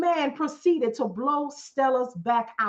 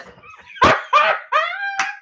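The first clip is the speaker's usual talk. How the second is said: shrill high burst of laughter; high squealing laugh; 5 dB louder than usual. Real, not acted. Laughing at own lie.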